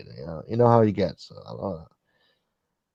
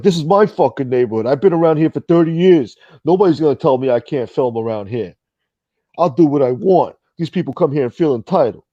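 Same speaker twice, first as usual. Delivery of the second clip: raised, higher voice ranting; mock angry rant; 5 semitones higher, 4 dB louder than usual. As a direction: imitate him blowing up about his neighborhood. Impersonating him ranting angrily.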